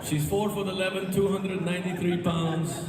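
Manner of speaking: high, pleading fake sob in a Mexican accent; mock crying plea. A man begging Trump to deport his mother-in-law, high mock sob. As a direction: beg in a put-on Mexican accent, voice high and wailing.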